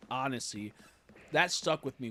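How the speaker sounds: ordinary talk, nothing marked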